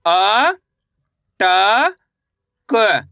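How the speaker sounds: long, held letters with gaps between; one stretched sound at a time. Shown as a demonstration. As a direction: spell it out slowly for pupils to repeat. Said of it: "एक अक्षर का उच्चारण करके".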